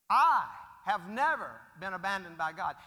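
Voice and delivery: loud, hammering I and never; emphatic declaration. Real, not acted. Preacher insisting to the congregation, loud. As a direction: proclaim it with full force.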